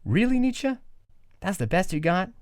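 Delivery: high-pitched, unimpressed disbelief; mock incredulous. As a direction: let the question leap up, as if badly let down. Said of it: "drop my chin into my hands really fast and go".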